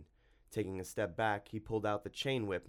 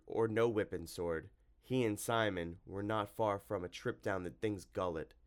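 plain reading voice

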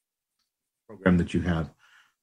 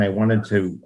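ordinary talk, nothing marked

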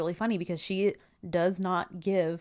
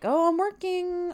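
high, drawn-out mock excuse; acted brush-off; 11 semitones higher, 3 dB louder than usual. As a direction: put off the caller, high and dragged out. Acting a busy woman brushing callers off.

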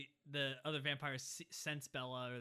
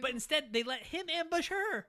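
exasperated; 11 semitones higher, 7 dB louder than usual. Real, not acted. Exasperated laughing over plot.

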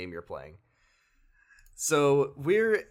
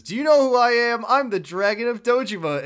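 loud, much higher braggart yell; mock boastful shout; 11 semitones higher, 10 dB louder than usual. Fake Kiryu bragging to the real one, loud mock boast. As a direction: puff up and shout who you are. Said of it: "the fake Kiryu says".

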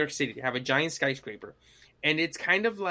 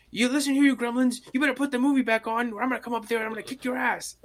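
high-pitched, fast wrestler threat; Hulk Hogan; 8 semitones higher, faster than usual. Retold or imitated. Angry audience member scolding gremlins from his seat, wrestler bluster. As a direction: threaten them like a hammed-up pro wrestler. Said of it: "Hulk Hogan in the audience".

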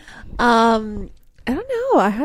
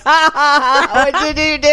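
laughing; 4 semitones higher, 4 dB louder than usual. Retold or imitated. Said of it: "I'm like".

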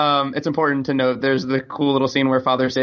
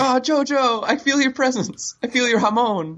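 mock mystic; 8 semitones higher than usual. Speedwagon mystical farewell.

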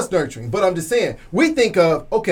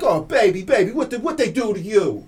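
mock doting mother; 3 semitones higher than usual. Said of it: "But women, it's like".

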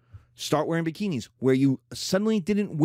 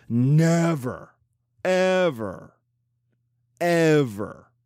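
emphatic never ever; 4 dB louder, much slower than usual. Real, not acted.

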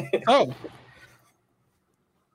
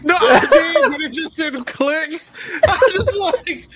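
high-pitched, talking through laughter; real laughing fit; 14 semitones higher, 6 dB louder than usual. Real, not acted. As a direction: explain it while cracking up. Laughing along at the story.

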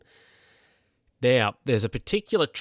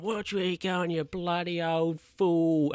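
higher-pitched, hammed-up road-rage abuse; half-acted insult yelled at the old driver; mock angry yell; 8 semitones higher, slower than usual. Driver mock-yelling at the old bloke. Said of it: "he's still yelling stuff".

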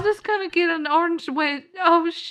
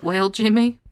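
deep character voice; 8 semitones lower than usual. Acted. Character voice, grave challenge.

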